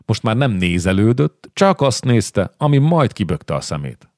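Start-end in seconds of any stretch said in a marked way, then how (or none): none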